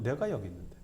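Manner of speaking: soft, low reproach; understated, quietly spoken reminder; Jesus. Acted. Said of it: "예수님의 책망은".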